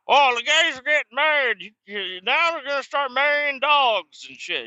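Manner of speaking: high, loud put-on voice of a crass old man; mocking the great uncle. Nephew mocking his bigoted great uncle, high loud put-on voice. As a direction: ham up the proud family asshole. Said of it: "He's like".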